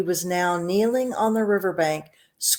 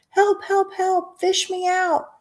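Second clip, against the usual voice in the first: put-on boy crying for help; 13 semitones higher, slower than usual. Drowning boy calling out.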